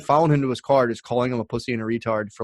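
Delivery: ordinary talk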